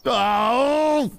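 high, drawn-out "oh" of alarm; startled cry. Real, not acted. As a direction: yelp as you get spotted. Spotted by the homeowner.

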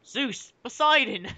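high, slow cry to the gods; hammed-up high invocation; mock scream to gods. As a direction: call on the gods in mock anguish. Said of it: "with a scream of the gods".

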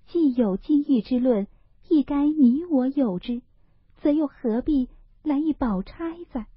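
put-on a frail girl weighing joy and grief; Daiyu's inner voice.

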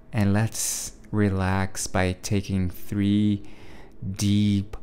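slow, calm meditation voice with long pauses; guided meditation. Real, not acted. Podcast host guiding listeners into a visualization, slow meditation voice. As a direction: lead them gently through it, unhurried, leaving space between phrases.

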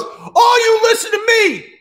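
high, full-force theatrical shout; rally a whole crowd of monsters at the top of your voice; acted outraged yell, very high; impassioned shouted proclamation. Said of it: "And he goes".

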